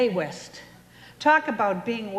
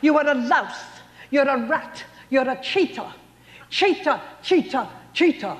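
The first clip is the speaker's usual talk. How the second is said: Bette Davis impression; 6 semitones higher than usual. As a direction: spit it out like a grand old film star dumping her husband. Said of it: "Perhaps you recall Betty Davis".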